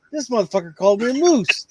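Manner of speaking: high-pitched offended grumble; indignant character voice. Acted. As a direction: sound high, insulted and huffy. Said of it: "and I'm like".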